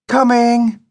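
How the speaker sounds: high, sing-out call; yelled reply. A narrator's reading. Daughter answers her father from upstairs, high mild yell. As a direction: call back in a high child's voice. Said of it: "yelled Judy".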